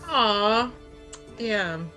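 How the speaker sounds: ordinary talk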